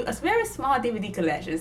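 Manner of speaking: ordinary talk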